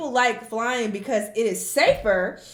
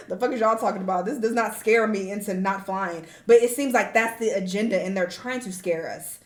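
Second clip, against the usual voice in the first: ordinary delivery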